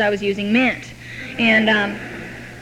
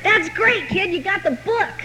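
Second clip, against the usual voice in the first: lightly voiced pleased older man, higher; hint of a proud mentor saying well done; mild cheerful praise; approving sponsor; 9 semitones higher, slower than usual. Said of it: "I remember he said".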